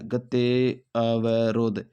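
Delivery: गत्यावरोध drawn out very slowly, syllable by syllable; slow careful model. Shown as a demonstration. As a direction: spell out गत्यावरोध for the class, very slowly. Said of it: "इसका गत्यावरोध होता है". (गत्यावरोध को बहुत धीरे, साफ़ बोलना)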